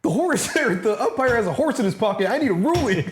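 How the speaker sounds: high, goofy put-on voice; silly umpire plea. Joker asking the umpire for a ruling, high and goofy. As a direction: plead like a whiny player.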